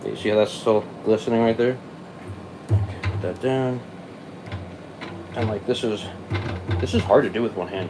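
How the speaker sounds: ordinary talk, nothing marked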